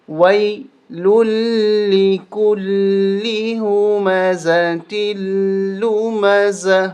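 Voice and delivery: drawn-out Quranic recitation; slow tajweed recitation. Shown as a demonstration. Teacher reciting the verse for students, slow and measured. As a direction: recite each word slowly and clearly. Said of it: "وی کو وے پڑھنا غلط ہے".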